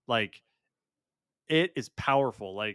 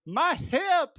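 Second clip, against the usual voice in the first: very high-pitched imitation of a hurt woman; high, comic wail of injury; mock pained cry; 3 dB louder than usual. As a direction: cry out in hammed-up pain. Imitates injured character crying out.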